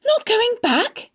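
high, slow, loud cry of disbelief; alarmed cry. A narrator's reading. Wilbur hears Charlotte will not go back, alarmed cry. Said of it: "he cried".

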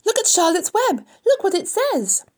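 very high, loud excited shouting; excited cry. A narrator's reading. Fern points out Charlotte's web, shouting excitedly. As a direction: call everyone to see, high and loud. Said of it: "cried Fern".

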